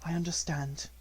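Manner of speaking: put-on grieving girl choking down tears; choked, low. A narrator's reading. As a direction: say it softly, holding back a sob. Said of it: "gulped down something".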